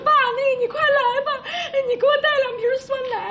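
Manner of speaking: high, fast tearful pleading with a laugh breaking through; crying plea. Retold or imitated. Daughter on the phone begging dad, crying high and fast. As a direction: sob out the request to dad. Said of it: "一边哭一边说".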